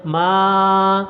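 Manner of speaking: ما held long, almost sung; ما chanted long; long madd vowel. Shown as a demonstration. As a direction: draw out the vowel of ما. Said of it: "لمبا کرنا ہے".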